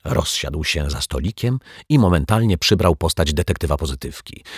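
ordinary delivery

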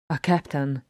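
ordinary delivery

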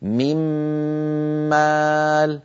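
من مال بميم مشددة وغنة كاملة; أظهر الغنة في الميم المشددة; إدغام بغنة. Shown as a demonstration. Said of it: "فصار النطق هكذا". (ميم مشددة بغنة كاملة)

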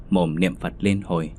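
plain reading voice

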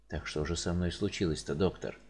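frightened patient, voice dropped low; anxious patient. Acted. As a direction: ask the doctor quietly, dreading the answer. Worried patient asks doctor.

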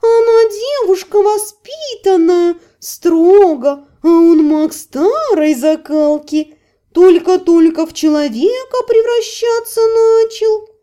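high, slow, giggling cat voice; laughing cat voice. A narrator's reading. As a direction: squeal it through laughter. Cat keeps laughing, mocking.

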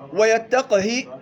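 ويتقه drawn out very slowly, each letter clear; slow recitation demo. Shown as a demonstration. Teacher showing students the Hafs reading, slow and exact. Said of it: "قرأ حفص ويتقه".

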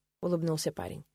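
usual speaking voice

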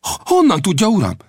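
blurting out in amazement; excited cry. Acted. Young Will cries out to Old Death in amazement.

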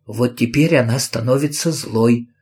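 plain reading voice

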